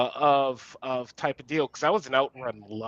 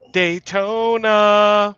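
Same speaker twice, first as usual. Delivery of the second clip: drawn-out hype call; 8 semitones higher, 7 dB louder, much slower than usual. Acted. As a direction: stretch it long and loud, higher. Hyping an old arcade game.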